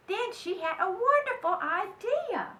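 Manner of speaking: usual speaking voice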